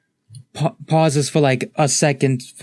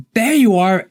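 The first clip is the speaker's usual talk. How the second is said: higher-pitched, excited call; loud exclamation; 9 semitones higher, 4 dB louder than usual. Acted. Dionysus pops out of the bag, exclaiming loudly. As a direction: exclaim it loudly on finding someone. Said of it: "he just loudly exclaims".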